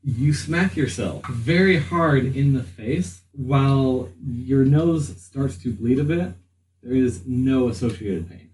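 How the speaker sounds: usual speaking voice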